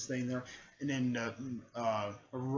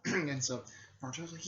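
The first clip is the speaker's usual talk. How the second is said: usual speaking voice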